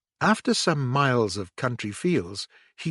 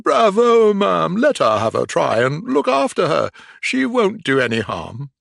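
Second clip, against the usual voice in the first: hearty cheer; 6 semitones higher, 6 dB louder than usual. A narrator's reading. Gentleman cheers loudly.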